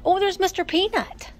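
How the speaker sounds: high-pitched delighted exclamation; real burst of excitement; excited discovery. Real, not acted. Spots a favourite collectible.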